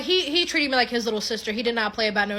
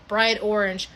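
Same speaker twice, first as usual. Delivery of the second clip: ordinary talk, nothing marked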